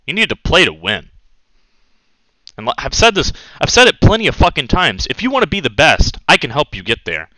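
normal talking voice

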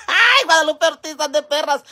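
high, loud exclamation after laughing; amused outcry. Real, not acted. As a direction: cry out high, fresh from a cackle. Exclaims right out of her laughing. (exclamación aguda entre risas)

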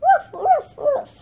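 very high little pretend woofs; puppy bark. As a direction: yap woof like a toy puppy. Toy puppy barking.

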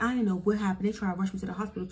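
ordinary talk, nothing marked

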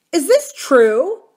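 loud, high, slow shocked question; shocked demand. A narrator's reading. Adam whirling on Lee, desperate to know, loud and high. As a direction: demand the truth in disbelief.

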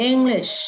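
"English" ending on a clear, full sh sound. Shown as a demonstration. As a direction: land hard on the sh in "English". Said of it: "ลงท้ายด้วย เอชเอช". (ลงท้าย "English" ด้วยเสียง ช ชัดๆ)

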